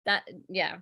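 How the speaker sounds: ordinary delivery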